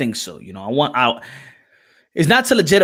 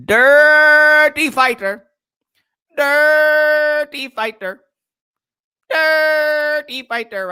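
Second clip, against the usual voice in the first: drawn-out mock voice; 12 semitones higher, 5 dB louder, much slower than usual. Voiced repeated catchphrase.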